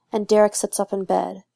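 ordinary delivery

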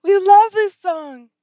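high, loud, drawn-out squealing chorus; excited squeal. A narrator's reading. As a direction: squeal it like thrilled fans at a bar. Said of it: "a half dozen voices start to squeal".

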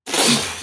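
sharp unvoiced disgusted gasp; high breathy gasp-laugh. Customer tasting the awful apple, gasping. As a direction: choke on the taste. Shocked gasp before punchline.

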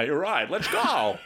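test-drive a goofy character voice; trying out a voice. Pretend. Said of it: "I'm gonna use that voice".

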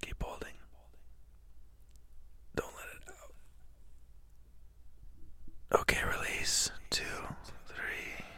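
whispered breathing count. Acted. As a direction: breathe the counts out like a meditation guide. Leads held breath, whispering counts.

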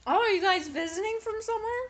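quick, high-pitched curious woman; yabancı kadın. Retold or imitated. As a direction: imitate a chirpy stranger's question, high and quick. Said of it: "the woman looks at us and she says".

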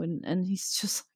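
ordinary talk, nothing marked

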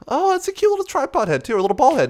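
high, patronising mock voice; condescending old guy. Narrator mocks the photographer belittling his tripod, high and patronising. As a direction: sneer at the small tripod.

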